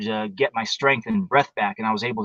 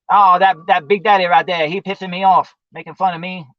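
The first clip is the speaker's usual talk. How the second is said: Dusty Rhodes ghost voice; 5 semitones higher, 4 dB louder than usual. Retold or imitated. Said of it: "he fucking heard me, going off".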